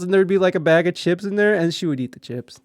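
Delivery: ordinary talk, nothing marked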